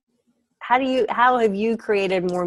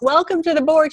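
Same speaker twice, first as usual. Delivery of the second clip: mock cheerful greeting; 10 semitones higher, 5 dB louder, faster than usual.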